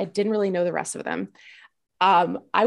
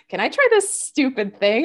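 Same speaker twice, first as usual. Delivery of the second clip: player's eager question, pitched up; imitated eager player; 5 semitones higher, 4 dB louder than usual. Player asking the dungeon master to allow something, imitated eagerness. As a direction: pipe up like a keen player, a bit higher. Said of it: "people being like".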